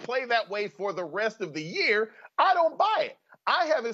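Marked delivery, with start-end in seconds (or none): 2.4-3.9 incredulous. Real, not acted. He flatly rejects the claim.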